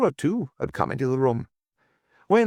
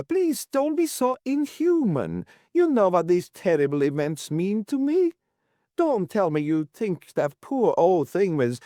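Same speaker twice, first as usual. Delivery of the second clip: distressed Mrs Worth, pleading; 9 semitones higher than usual. A narrator's reading. Landlady begging Toby not to be inhuman, pleading. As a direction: beg in the landlady's high voice.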